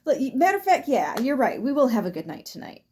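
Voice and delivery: hammed-up happy change of heart; mock sudden relief. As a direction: switch to cheerful agreement. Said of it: "they're like".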